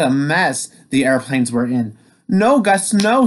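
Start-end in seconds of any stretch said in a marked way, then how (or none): 2.3-3.3 calling out. Acted. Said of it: "said Sam".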